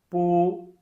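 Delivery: loud "Bu" held high and level; first tone, flat. Shown as a demonstration. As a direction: sustain "Bu" on one high note. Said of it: "all the four tones with bu".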